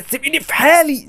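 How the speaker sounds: high, pleading cry to be left alone; desperate plea. Real, not acted. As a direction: beg her to let you be. Begging the granny to stop.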